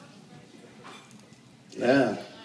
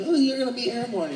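proud dad; 9 semitones higher, 4 dB quieter, faster than usual. Retold or imitated. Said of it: "he always wanted me just like him".

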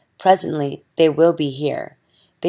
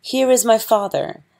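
put-on higher, a young girl's startled cry; 6 semitones higher than usual. A narrator's reading. Young princesses seeing their father's ghost, crying out higher. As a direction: call out at the sight of the ghost. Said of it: "Hain and Zabi cried".

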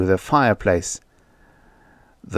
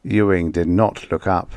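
usual speaking voice

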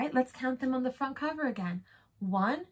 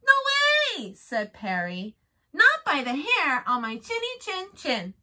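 pig voice; 5 semitones higher, 4 dB louder than usual. Acted. Pig refuses the wolf.

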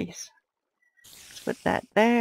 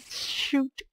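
mock mob voice; 7 semitones higher, 4 dB quieter than usual. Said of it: "looked me right in the face and said".

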